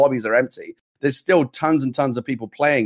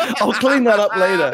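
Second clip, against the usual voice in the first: laughing; 9 semitones higher, 4 dB louder, slower than usual. Real, not acted. Laughing after spit take.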